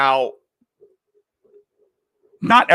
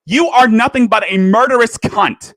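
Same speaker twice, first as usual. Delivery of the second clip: loud and higher, spitting it out; furious insult; 5 semitones higher, 6 dB louder than usual. Real, not acted. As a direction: hurl the insult with full fury. Angry rant at a YouTuber.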